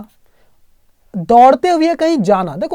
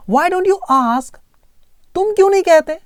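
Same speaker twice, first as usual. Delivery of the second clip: usual speaking voice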